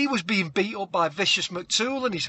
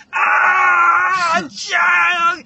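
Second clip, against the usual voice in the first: high, very loud drawn-out wail; hammed-up agonised shriek; scream of pain; 12 semitones higher, 9 dB louder than usual. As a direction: scream as if tape tore the lip off. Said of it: "she goes".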